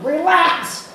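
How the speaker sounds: high, loud yell; screaming. Retold or imitated. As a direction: yell it in her ear. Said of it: "while screaming".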